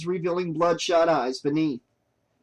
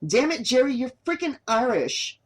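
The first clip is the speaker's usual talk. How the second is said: higher, exasperated scolding; frustrated; 8 semitones higher than usual. A narrator's reading. Boss reminding her cameraman in frustration he is Irish. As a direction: snap at him in frustration. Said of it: "she reminded him in frustration".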